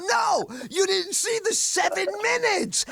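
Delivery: high insistent yell, hammed-up; mock protesting shout. Young comic protesting to the booker, mock shout.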